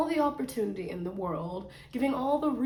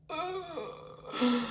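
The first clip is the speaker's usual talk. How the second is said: a high, soft whimpering ugh; a mock cry of frustration; whiny ugh; 8 semitones higher, 4 dB quieter than usual. Real, not acted. Complaining about TikTok pay, a high mock whimper.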